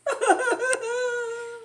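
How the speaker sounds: a high, loud squeaky laugh; high character laugh. Acted. The doctor laughing at the sneezing elephant, in a high squeaky laugh.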